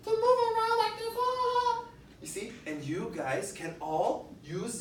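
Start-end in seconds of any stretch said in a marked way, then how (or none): 0.0-1.8 much higher, louder, sing-song puppet voice; high dolphin puppet voice. Shown as a demonstration. Teacher shows Dolphy moving its tail, squeaky voice. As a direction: make Dolphy swim and squeal. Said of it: "So I can".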